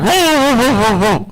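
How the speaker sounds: high-pitched mock dog barks; comic imitation of a German Shepherd barking; barking dog impression. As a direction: bark it out like a dog, high and falling. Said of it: "he was talking to his master and shit".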